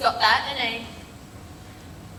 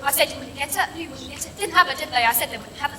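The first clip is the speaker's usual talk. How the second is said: ordinary talk, nothing marked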